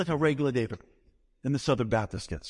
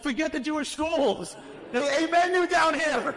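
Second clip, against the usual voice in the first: elated insistence; 13 semitones higher than usual. Retold or imitated. Dismisses the wife's worry about schools, high and laughing. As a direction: brush off her worry in delight. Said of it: "I'm like".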